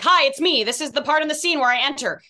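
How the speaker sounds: high, loud, cheery entrance voice; hammed-up castmate announcing himself; imitating Philly. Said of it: "Philly will walk in and be like".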